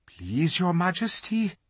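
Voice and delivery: a hint of a nervous old fox. A narrator's reading. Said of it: "said the fox".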